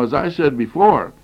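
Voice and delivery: normal talking voice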